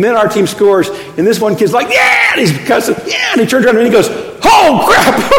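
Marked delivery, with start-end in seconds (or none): 1.9-2.3 high-pitched imitation of a whooping teenager; rowdy cheer. 3.1-3.3 imitated teenage whoop; rowdy cheer. 4.4-5.3 loud, high mock shriek of dismay; startled yelp. Kid turns and sees the preacher.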